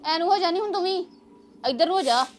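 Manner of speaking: high, loud shoo. Real, not acted. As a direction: shoo the animal aside loudly. Shooing an animal aside.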